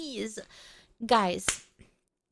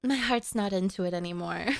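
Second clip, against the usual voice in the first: dejected; 8 semitones lower, 4 dB quieter than usual. Real, not acted. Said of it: "the feeling is gone".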